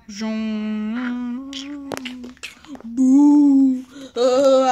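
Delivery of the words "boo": loud spooky boo, pitched a little up; ghost boo. Acted. Kid playing ghost shouts a spooky boo. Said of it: "I'm a ghost".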